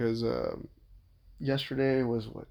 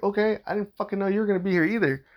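higher-pitched, indignant comeback; retold irritated retort; 8 semitones higher, 4 dB louder, faster than usual. Husband answering his father-in-law's excuse, irritated. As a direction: throw the excuse back, pitched up.

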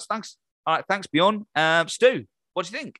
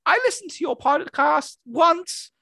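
squeaky insults, rising to a higher yell; 10 semitones higher than usual. Acted.

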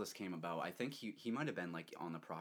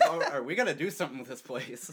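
mock surprise; 7 semitones higher, 11 dB louder than usual. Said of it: "they're like".

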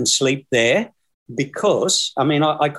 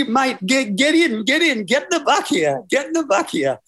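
driver voice; 11 semitones higher than usual. Retold or imitated. Driver urging him in.

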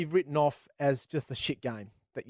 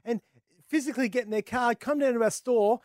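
high, eager pitchman building to a peak; pleading sales pitch; 6 semitones higher than usual. Acted. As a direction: plead the case, rising higher to the end. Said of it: "and saying to them".

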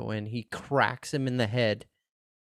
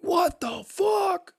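much higher, slow shocked yell; stunned shout; much slower than usual. Retold or imitated. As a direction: scream out your disbelief. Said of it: "And you're like".

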